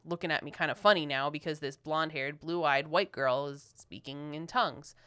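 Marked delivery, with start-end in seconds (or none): none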